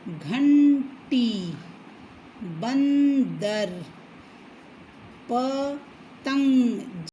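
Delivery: each word stretched very slowly with the nasal held; teacher drawing out the nasal words; slow word demo. Shown as a demonstration. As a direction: sound out घंटी, बंदर, पतंग syllable by syllable. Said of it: "देखिए".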